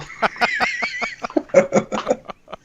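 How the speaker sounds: high squealing laugh of delight. Real, not acted. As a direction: burst into a giddy laugh. Delighted by cute drink.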